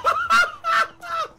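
very high, loud cackle; shrieking laugh. Real, not acted. Killed right after spawning.

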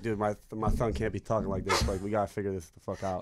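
normal talking voice

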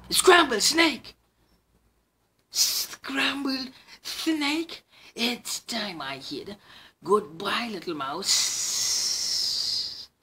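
high, loud panicked snake; frightened snake. Acted. Snake panics at the mouse's words. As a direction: cry out then slink off.

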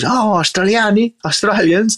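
high-pitched, delighted exclamation of discovery; excited recognition. Retold or imitated. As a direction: pipe up high as you spot foreigners. Retold vendor spotting Australian tourists.

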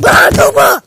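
very loud outburst; yelled name. Acted. Koopa keeps announcing the name, now yelling. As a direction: blurt the name in a full shout.

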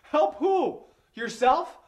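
high, loud mocking yell; scornful shout. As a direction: throw it back at him. Scornful retort at intruder.

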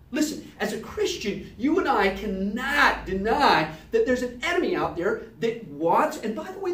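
ordinary talk, nothing marked

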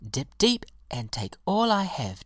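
high-pitched, generous delight; joyful. A narrator's reading. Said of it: "cried the violet joyfully".

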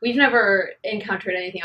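normal talking voice

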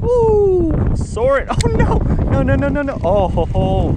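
high excited whoops and groans; whooping as the fish gets away; excited yelling. Real, not acted. Losing a fish, wind.

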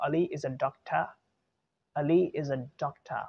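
"doctor" ending in uh, no R; slow model sentence, "doctor" as DOK-tuh; schwa ending in sentence. Shown as a demonstration. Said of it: "अगर मैं sentence की बात करूँ".

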